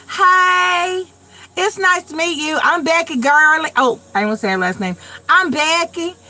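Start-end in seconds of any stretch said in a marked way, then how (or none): 0.1-3.7 high, hammed-up country twang; thick Southern accent. Roommate introducing herself on first meeting, exaggerated Southern accent. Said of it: "It was the most southern accent". 5.3-6.2 high country twang, hammed up; mock a very Southern self-introduction; thick Southern accent. Roommate saying where she's from, exaggerated Southern accent. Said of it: "It was the most southern accent".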